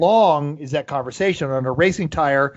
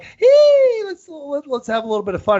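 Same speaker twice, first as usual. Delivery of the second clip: playful car voice; 12 semitones higher, 6 dB louder than usual. Retold or imitated. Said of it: "But the Boxster's like".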